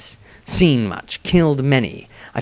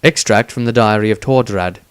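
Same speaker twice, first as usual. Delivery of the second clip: normal talking voice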